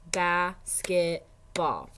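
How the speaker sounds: Basketball said slowly, each syllable apart; syllables drawn out. Shown as a demonstration. Teacher showing parents the syllables, slow and clear. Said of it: "So it has three syllables".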